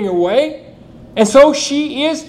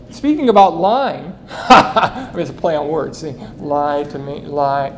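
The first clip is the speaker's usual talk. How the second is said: chuckling through the words; amused laugh over a pun; 7 semitones lower than usual. Real, not acted. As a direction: laugh at your own joke as you say it. Laughs at his own pun.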